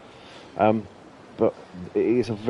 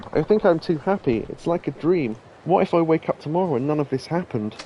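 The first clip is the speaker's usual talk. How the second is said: anxious hostess; 7 semitones higher than usual. Acted. Reads hostess dialogue aloud.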